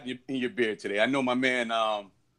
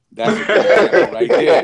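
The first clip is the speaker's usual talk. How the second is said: laughing; 5 semitones higher, 6 dB louder than usual. Real, not acted. Teasing a panel member.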